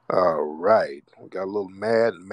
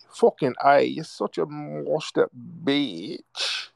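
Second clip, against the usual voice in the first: put-on higher, very slow caricature of a European accent; mock European accent; 6 semitones higher, slower than usual. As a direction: draw the insult out in a mocking European accent. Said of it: "With that little moist European accent".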